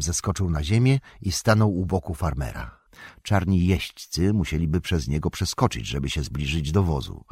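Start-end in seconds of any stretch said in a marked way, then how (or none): none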